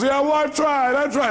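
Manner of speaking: high-pitched imitation of a defensive client; mock-exasperated excuse; protesting caller. Voices caller protesting to the coach.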